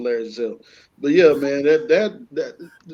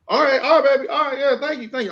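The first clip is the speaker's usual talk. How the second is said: much higher, eager wife voice; playing the wife; 10 semitones higher than usual. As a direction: chirp it sweetly. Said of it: "Get in that passenger seat".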